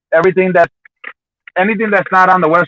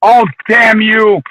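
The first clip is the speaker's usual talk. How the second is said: mock outrage; 6 semitones higher, 4 dB louder, much slower than usual. Mock outrage at rival fan.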